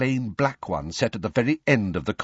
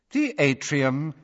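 slow, higher, cool announcement; cool female voice; 5 semitones higher, slower than usual. A narrator's reading. Lift voice announcing the floor, cool. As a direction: announce it coolly and slowly. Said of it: "said the cool female voice".

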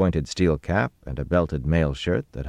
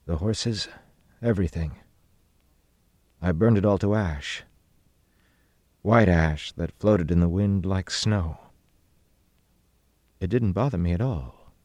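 subdued, unemotional account; flat recital with pauses between phrases; 4 semitones higher than usual. A narrator's reading. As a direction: tell it flatly, as if untroubled. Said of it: "he said in a flat voice".

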